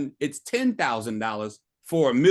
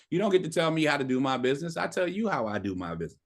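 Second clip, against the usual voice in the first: ordinary delivery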